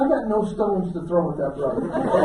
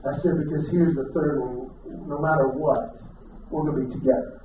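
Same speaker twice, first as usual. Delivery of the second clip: low, soft heartfelt apology; tender sincerity; 7 semitones lower, 7 dB quieter than usual. Retold or imitated. Husband apologising to his wife that night, low tender sincerity. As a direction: lower the voice and speak earnestly.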